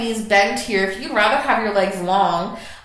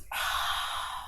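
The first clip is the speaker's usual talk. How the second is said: breathy demo exhale; 11 dB quieter than usual. Shown as a demonstration. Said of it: "And exhale".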